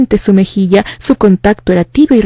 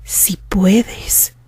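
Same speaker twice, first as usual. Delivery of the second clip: soft unvoiced whisper, eerily calm; hushed and chilling; calm, menacing whisper; 7 dB quieter than usual. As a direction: whisper it like a quiet threat. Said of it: "con la voz calmada, pero terrorífica".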